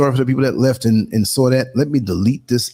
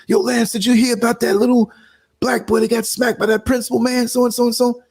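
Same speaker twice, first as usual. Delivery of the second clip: excited caller voice; 11 semitones higher than usual. Retold or imitated. Voicing a listener's voicemail.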